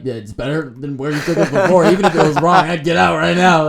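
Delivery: loud, laughing exasperation; exasperated, loud. Retold or imitated. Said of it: "And I was like".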